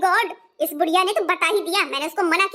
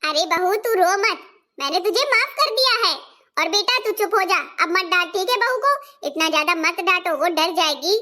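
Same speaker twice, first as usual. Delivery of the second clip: put-on lower, gentle older mother calming her son; motherly, a touch lower, pleading for the bahu; mother-in-law defending the crying daughter-in-law, lower gentle voice; mother-in-law soothing; 4 semitones lower than usual.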